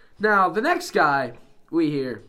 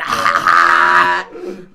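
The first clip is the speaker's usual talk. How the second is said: deep loud laugh; big low belly laugh; low chuckle; 12 semitones lower, 10 dB louder than usual. Real, not acted. Laugh after joke.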